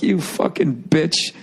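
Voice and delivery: slow, high-pitched imagined taunt; drawn-out mock jeer; mocking put-down. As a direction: imitate someone sneering at your snack. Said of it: "like he was shitting on me".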